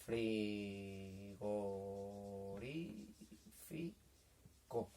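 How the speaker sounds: frigorífico drawn out very slowly and quietly, fri... go... rí... fi... co; syllable by syllable. Shown as a demonstration. Teacher writing the word for children, frigorífico slowly broken into syllables. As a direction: softly spell out frigorífico in syllables with long gaps. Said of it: "¿cuántos golpes de voz?".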